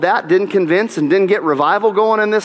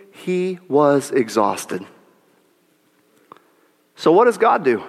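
normal talking voice

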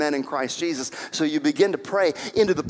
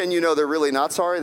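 ordinary talk

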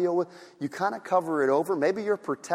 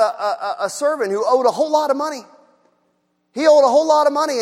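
normal talking voice

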